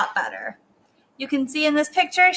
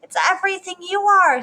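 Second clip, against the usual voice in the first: high, lilting reassurance; high motherly coo; doting mother; 7 semitones higher, 3 dB louder than usual. A narrator's reading. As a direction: comfort your child with fond praise. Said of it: "said her mother".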